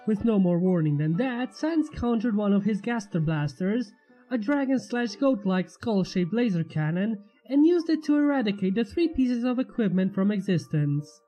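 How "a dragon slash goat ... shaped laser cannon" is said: plain reading voice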